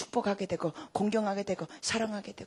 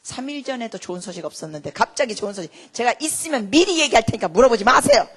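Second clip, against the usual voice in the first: irritated daughter, building louder; retold annoyance; 8 dB louder than usual. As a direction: re-enact fed-up pleading, ending loud. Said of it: "나중엔 제가 짜증낼 때가 있어요". (점점 커지는 짜증을 재연)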